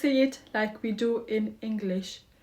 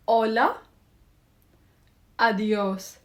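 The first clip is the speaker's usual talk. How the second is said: clear and confident. Shown as a demonstration. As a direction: model both greetings clearly, with confidence. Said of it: "clearly and confidently".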